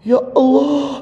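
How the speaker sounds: high, hammed-up plea of "Ya Allah"; preacher demos forcing tears in prayer; mock crying prayer. Shown as a demonstration. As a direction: fake a tearful cry to God, pitched high. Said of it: "Gini aja contohnya".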